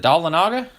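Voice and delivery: questioning Daulanaga as DAH-luh-NAH-guh, rising; unsure mispronunciation. Shown as a demonstration. Host checks a city name with viewers, unsure mispronunciation. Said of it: "I'm probably not saying it right".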